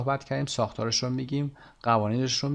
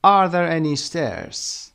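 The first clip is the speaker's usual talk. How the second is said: slow, louder, stress on "Are" and "stairs"; rhythm model; 4 semitones higher, 4 dB louder, much slower than usual. Shown as a demonstration. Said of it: "copy the rhythm". (ریتم جمله با تأکید)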